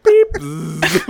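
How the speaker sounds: microwave beep, then a buzz; imitated microwave noise. Friend describing a joke ASMR video, imitated microwave sounds. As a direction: mimic the beep and hum of a microwave. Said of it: "sound like".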